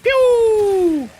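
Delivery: high, loud mock laser shot; pew gun noise. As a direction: zap it like a toy gun.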